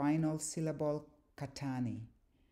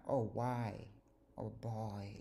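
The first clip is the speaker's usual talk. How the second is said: exasperated despair; 4 semitones lower, much slower than usual. Acted. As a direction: sigh out a mild exasperation. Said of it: "exasperation, despair".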